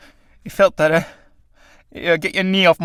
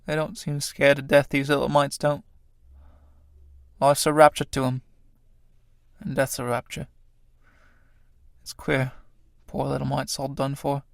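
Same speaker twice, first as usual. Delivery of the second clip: much softer, to himself; hushed grief; 7 dB quieter than usual. Acted. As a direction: murmur over the dead body. Tramp mourning the dead moths.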